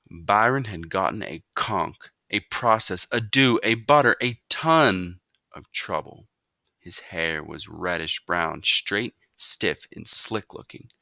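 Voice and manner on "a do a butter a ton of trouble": ordinary delivery, nothing marked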